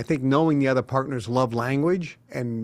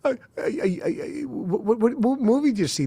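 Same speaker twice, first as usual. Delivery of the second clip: flustered; 10 semitones higher than usual. Retold or imitated. Flustered question to crying women.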